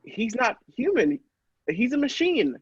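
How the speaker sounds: high, astonished realisation; stunned disbelief. Acted. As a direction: say it as it dawns on you. First sight of the robot.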